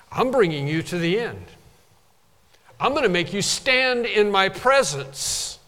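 ordinary delivery

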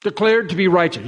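ordinary talk, nothing marked

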